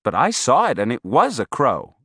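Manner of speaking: usual speaking voice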